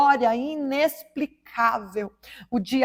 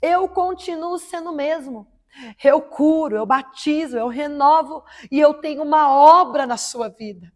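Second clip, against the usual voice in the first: higher, louder, slow and commanding divine voice; God's voice shouting; 4 semitones higher, 5 dB louder, slower than usual. Retold or imitated. Retelling how God called her name, loud and commanding. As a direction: proclaim it like a voice from heaven thundering. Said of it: "aquela voz que gritou assim".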